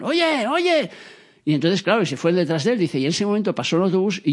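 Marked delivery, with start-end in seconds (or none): 0.0-0.9 imitando un grito agudo de guardia; llama al que se escapa, alto y agudo; guardias gritando. Retold or imitated. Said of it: "le gritaron". (grito imitado de guardia)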